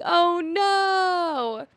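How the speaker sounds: high, drawn-out mock wail; mock dismay. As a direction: wail it in exaggerated alarm. Group's dismay at being caught, acted.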